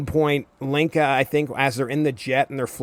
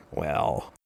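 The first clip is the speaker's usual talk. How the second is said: normal talking voice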